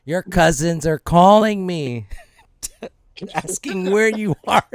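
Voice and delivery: slow, drawn-out scolding; disappointed mom. Acted. Mom confronting her son over what he did, slow and disappointed. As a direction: stretch each word like a hurt mother. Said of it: "And she's like".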